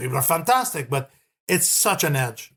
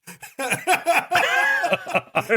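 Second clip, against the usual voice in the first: high burst of laughter; real high-pitched belly laugh; big laugh; 12 semitones higher than usual. Real, not acted. Joking about teacher's harsh criticism.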